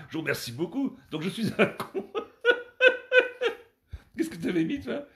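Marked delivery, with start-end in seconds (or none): 2.1-3.5 laughing hard and very high; a real squeal of laughter at himself; high shrieking laugh. Real, not acted. Laughs again at the riddle.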